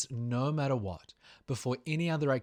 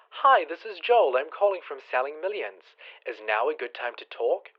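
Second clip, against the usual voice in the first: loud, eager cold-caller script read, higher at the start; generic telemarketer voice; 3 semitones higher, 9 dB louder than usual. Retold or imitated. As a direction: sound like every pushy cold caller reading the script. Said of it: "most scripts are some variation of".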